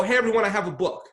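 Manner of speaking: loud, bright mock announcement; proud announcement. Mocking writers who announce out of nowhere, proudly. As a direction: burst out with the big reveal. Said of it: "they come out and go".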